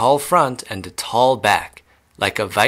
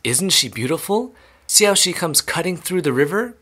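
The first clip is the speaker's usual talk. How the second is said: high, lilting pride; beaming pleasure; 7 semitones higher than usual. A narrator's reading. Wonka admiring his pink boat, beaming pleasure. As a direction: admire it aloud, pitched well up. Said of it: "beaming with pleasure".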